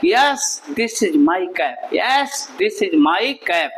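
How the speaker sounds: "Yes, this is my cap" slow, low and falling; model answer intonation. Shown as a demonstration. Teacher modelling the reply's tone, slow low intonation. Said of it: "अब देखिए मेरा tone किस तरह से चल रहा है".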